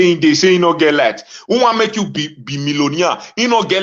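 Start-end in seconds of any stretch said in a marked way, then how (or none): none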